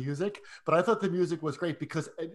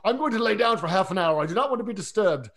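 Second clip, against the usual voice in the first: loud, higher, badly faked Scottish accent; attempted Scottish accent; 4 semitones higher, 4 dB louder than usual. Host quoting the film's policeman, attempted Scottish accent. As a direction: have a weak go at a stern Scottish accent. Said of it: "I can't do my Scottish accent".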